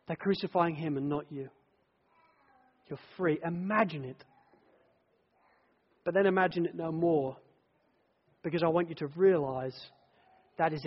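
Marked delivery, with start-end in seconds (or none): none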